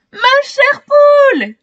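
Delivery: high, slow overjoyed cry; joyful cry. A narrator's reading. Easy Pipic greets his lost hen with a cry. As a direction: cry out with delight, stretching the words. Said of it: "crie Easy Pipic".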